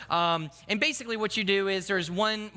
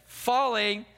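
drawn-out, higher-pitched warning call; hesitant announcement; 5 semitones higher, 3 dB louder than usual. Acted. Teen very slowly creeping back into second fall, hesitant. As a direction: stretch the word warily before letting go. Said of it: "I'm very hesitant to be able to trust them again".

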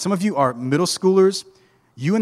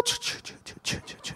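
soft, voiceless "ch, ch" clicks; mock drumroll noise; 13 dB quieter, faster than usual. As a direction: make a whispered drumroll. Drumroll noise while opening email.

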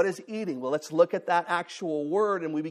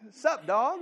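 slang greeting; 7 semitones higher than usual. Retold or imitated. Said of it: "that's how we talk".

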